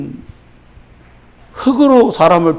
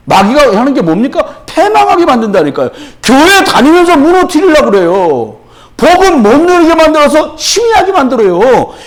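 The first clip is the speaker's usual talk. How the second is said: raised, insistent sermon voice; impassioned preaching; 10 semitones higher, 5 dB louder than usual. Real, not acted. Preacher exposing the devil's work to the congregation, forceful preaching. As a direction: hammer out each charge with a high pitch.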